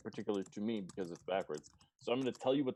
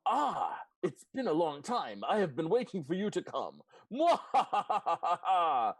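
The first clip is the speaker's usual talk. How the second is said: high villain voice ending in a cackle; mad scientist; 7 semitones higher, 5 dB louder, slower than usual. Acted. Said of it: "you hear, uh, in your mind".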